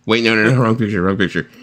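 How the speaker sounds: ordinary talk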